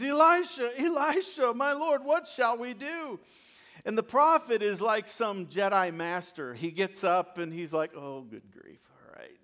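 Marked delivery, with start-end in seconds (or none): 0.0-3.2 high, loud mock cry of alarm; freaked-out servant calling urgently for help; acted panicked scream. Frightened servant runs inside to Elisha, mock panicked scream. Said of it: "he screams". 7.9-9.4 low, very soft offhand grumble; cool Jedi master, barely troubled by the fuss; calm, unbothered. Acted. Prophet gets up to answer his panicked servant, calm and unbothered. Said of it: "totally calm and cool and collected".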